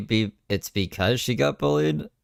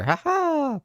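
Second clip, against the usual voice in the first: a sharp, much higher fake "ha ha"; mock laugh. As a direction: throw out a taunting fake laugh. Mocking her Hitler comparison.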